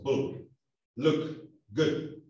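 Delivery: BULL, LOOK, GOOD with short, lax vowels; boca plana. Shown as a demonstration. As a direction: keep lips flat on BULL, LOOK, GOOD. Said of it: "La boca queda plana". (BULL, LOOK, GOOD cortos, con la boca plana)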